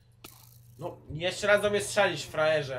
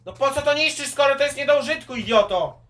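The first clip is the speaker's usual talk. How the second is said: angry yell; 9 semitones higher, 13 dB louder than usual. Real, not acted. Scolds teammate breaking crops.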